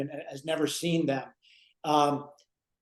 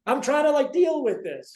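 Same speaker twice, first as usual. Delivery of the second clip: exasperated; 9 semitones higher than usual. Retold or imitated. Ralph fed up with Norton.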